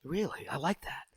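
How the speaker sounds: voiceless, soft aside; whispered approval. Real, not acted. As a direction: murmur it close to the mic.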